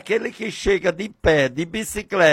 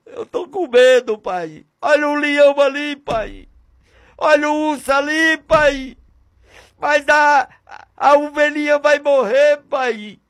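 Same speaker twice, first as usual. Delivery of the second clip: put-on frightened boy; 7 semitones higher than usual. Young David afraid, calling father.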